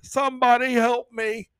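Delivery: high, hammed-up panicked whine; mock offended person. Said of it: "what he said".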